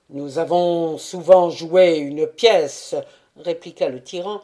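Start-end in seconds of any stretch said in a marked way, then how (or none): 0.1-3.0 cavernous voice. A narrator's reading. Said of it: "de sa voix caverneuse".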